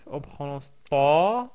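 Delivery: « ta » allongé, voyelle tenue; étirez le « ta »; ta long. Shown as a demonstration. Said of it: "voyelle langue alaf". (« ta » long)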